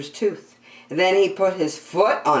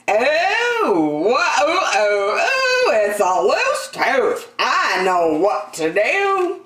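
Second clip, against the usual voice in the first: fussing dentist; 5 semitones higher, slower than usual. A narrator's reading. Dentist voiced in story.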